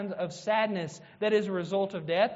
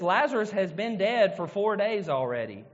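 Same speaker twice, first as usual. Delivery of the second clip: ordinary delivery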